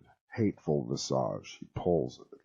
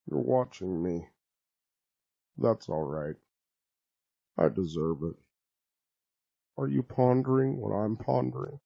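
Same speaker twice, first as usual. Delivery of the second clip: a touch of weary, resigned sorrow; understated forlorn Brain rising higher at the question; 7 semitones higher, 3 dB louder than usual. A narrator's reading. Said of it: "the Brain's voice is forlorn and resigned".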